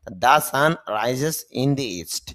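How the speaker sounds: drawn out, word by word English example; very slow model phrase. Shown as a demonstration. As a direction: stretch it out for the learner. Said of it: "teacher models full sentence". (খুব ধীরে টেনে টেনে উদাহরণ)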